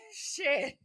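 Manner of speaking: high laughing breath on Shit. Real, not acted. Cracking up at her own taunt, laughing. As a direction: laugh through the word.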